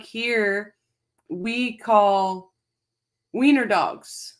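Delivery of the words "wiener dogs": usual speaking voice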